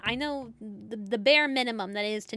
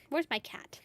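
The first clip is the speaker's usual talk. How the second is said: hushed whisper; 3 dB quieter than usual. Real, not acted. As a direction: whisper it, looking around. Searching for cat in game.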